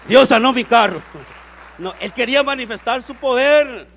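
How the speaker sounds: loud, high-pitched triumphant proclamation; full-force preaching. Real, not acted. (proclamación a toda voz)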